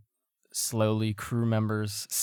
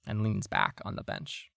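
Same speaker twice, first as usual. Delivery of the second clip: ordinary talk, nothing marked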